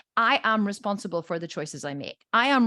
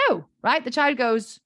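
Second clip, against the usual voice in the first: ordinary delivery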